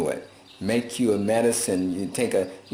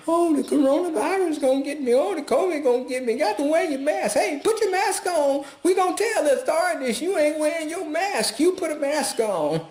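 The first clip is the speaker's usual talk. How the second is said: much higher, hammed-up panicky nagging; mocking scared people. Mocking viewers scared by TV, panicky mock voice. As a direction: parody frightened TV watchers fussing about masks. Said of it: "y'all afraid".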